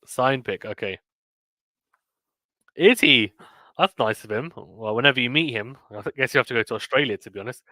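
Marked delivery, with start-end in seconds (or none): none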